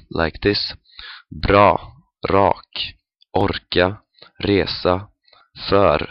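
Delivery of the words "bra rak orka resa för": bra, rak, orka, resa, för with a rolling R; trilling Swedish R in bra, rak, orka, resa, för; trilled R. Shown as a demonstration. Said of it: "the trilling or rolling R".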